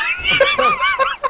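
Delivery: high shrieking laugh. Real, not acted. Burst of laughter.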